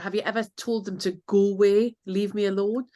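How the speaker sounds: ordinary talk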